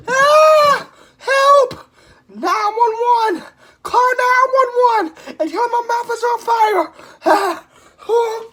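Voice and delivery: high, loud, crying screams; frantic screaming. Real, not acted. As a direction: shriek for help in real panic. Screaming for help, mouth burning.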